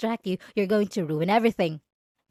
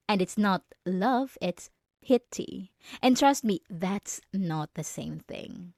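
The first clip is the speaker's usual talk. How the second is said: slow cold verdict; slower than usual. Acted.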